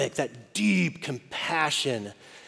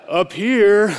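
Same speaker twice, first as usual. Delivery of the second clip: calling back; 6 semitones higher, 9 dB louder than usual. Retold or imitated. Dad calls back to daughter.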